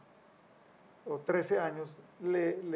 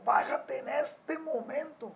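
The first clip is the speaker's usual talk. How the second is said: higher, slow stern command to come down; retold stern yell; 8 semitones higher, slower than usual. Father ordering his angry son downstairs, stern and slow. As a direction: order the son down at once, slowly and hard. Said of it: "Le grito".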